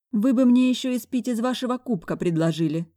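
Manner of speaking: high, loud indignant retort; indignant girl. A narrator's reading. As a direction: snap back, high. Secretary retorts narrowing eyes.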